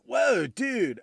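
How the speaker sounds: put-on high, drawn-out surfer-dude "Whoa, dude" in a California surfer accent. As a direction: imitate a stoned California surfer kid's accent. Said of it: "that fairly strong".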